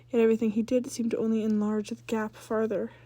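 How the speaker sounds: ordinary delivery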